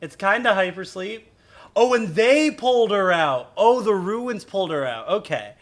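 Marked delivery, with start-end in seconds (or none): none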